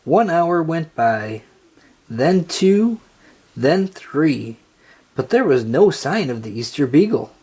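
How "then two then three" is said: ordinary delivery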